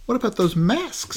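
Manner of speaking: high light Velma voice; lightly voiced curious girl. Velma quoted in recap.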